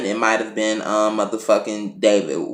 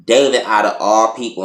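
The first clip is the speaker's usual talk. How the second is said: usual speaking voice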